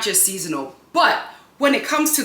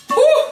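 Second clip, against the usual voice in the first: effort exhale; 6 dB louder than usual. Real, not acted. Lifting heavy pot.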